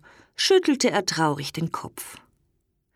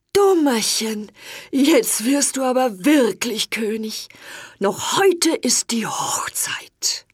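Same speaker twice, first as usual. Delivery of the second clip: a lightly voiced old king, glad and a bit higher; joyful old king; 4 semitones higher than usual. A narrator's reading. King rejoices at Dummerchen's princess, lightly voiced and glad. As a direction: cheer like a delighted old king.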